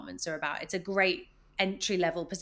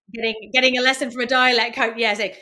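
ordinary talk

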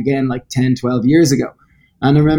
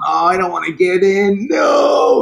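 mock whimper; 7 semitones higher, slower than usual. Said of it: "I was nearly crying like a little baby".

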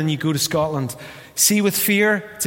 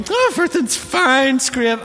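high, sing-song fake cheer, much higher; mock cheerful brush-off; 11 semitones higher, 4 dB louder than usual. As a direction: chirp "everything's fine" like a breezy brush-off. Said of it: "You go".

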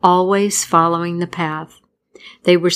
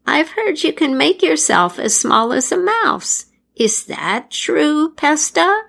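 put-on imploring old crone; 9 semitones higher than usual. A narrator's reading. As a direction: beg sweetly in a high crone voice. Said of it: "the crone implored".

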